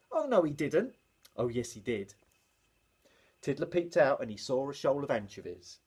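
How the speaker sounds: ordinary delivery, nothing marked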